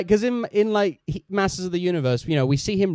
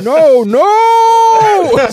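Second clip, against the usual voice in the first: high, loud shriek of protest; panicked cry; 11 dB louder than usual. Acted. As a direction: yell no in panic. Vader protests cancellation.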